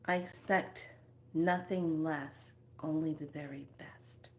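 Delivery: slow, fading into a whisper; slow repeat. Shown as a demonstration. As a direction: let it sink in, slowly, then whisper. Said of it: "Let me repeat that".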